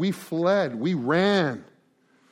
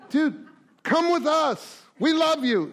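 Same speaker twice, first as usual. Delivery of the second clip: higher, slow, coaxing plea; pleading friend; 9 semitones higher than usual. Acted. Friend begs the lonely guy to join them, high and slow. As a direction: stretch it out, begging him to come.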